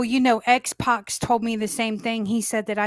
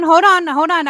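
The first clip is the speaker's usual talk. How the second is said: loud insistent hold on, cutting in; loud, pushy cross-talk to stop an interruption; talking over; 6 semitones higher, 8 dB louder than usual. Real, not acted. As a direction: raise your voice to get the floor back. Talking over the other host.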